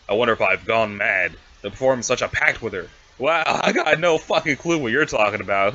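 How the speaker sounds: normal talking voice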